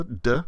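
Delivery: "d" said alone as a short consonant sound; isolated d sound. Shown as a demonstration. Teacher sounding out the start of deal, isolated d sound. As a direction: sound out "d", not the letter name. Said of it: "would start with a delta".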